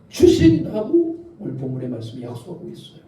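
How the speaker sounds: ordinary talk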